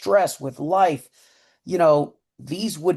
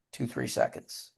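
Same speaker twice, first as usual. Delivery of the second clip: whispered count; 4 semitones lower, 11 dB quieter than usual. Shown as a demonstration. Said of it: "exhale through the mouth".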